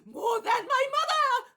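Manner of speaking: very high, loud wailing cries; frenzied shrieking. Acted. Simon raving at the onlookers, very high wailing shout. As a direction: rave and point in terror. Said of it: "he shouts and shouts and shouts".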